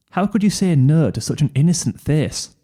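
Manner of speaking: ordinary delivery, nothing marked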